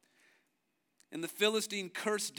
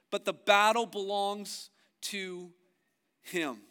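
fervent preaching; 4 semitones higher, 8 dB louder, slower than usual. Acted. Preaching at full force.

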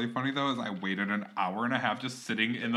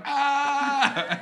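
retold shriek; 13 semitones higher, 6 dB louder than usual. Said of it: "And I was just like".

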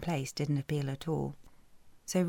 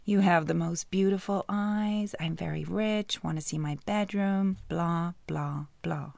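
mocking his pickup line. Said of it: "you know how the story goes".

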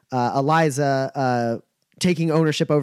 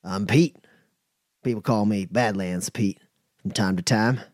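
lower Pete voice; 6 semitones lower than usual. Acted. GM introduces Pete in character.

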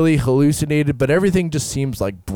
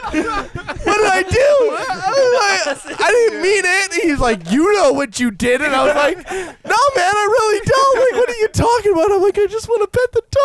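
confused pleading. Retold or imitated. Retold pleading with stern brother.

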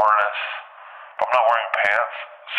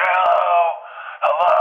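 high, loud hello; ringing high holler into an empty house; calling out; 9 semitones higher, 3 dB louder than usual. Retold or imitated. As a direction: call out for anyone home. Calling out, nobody answers.

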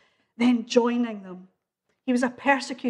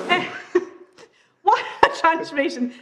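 laughing; 4 semitones higher, 4 dB louder than usual. Real, not acted. Laughs at the bouncing ball.

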